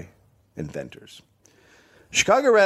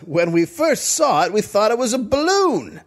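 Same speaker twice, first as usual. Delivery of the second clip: hammed-up old-time witness; old-timey witness; 7 semitones higher than usual. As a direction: read the quote like an old-time eyewitness.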